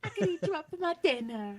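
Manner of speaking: high, put-on Tennessee accent from a Brit; British Tennessee accent. Repeating the line for laughs, British Tennessee. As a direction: lift it into a mock Tennessee twang. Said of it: "Do a British Tennessee accent".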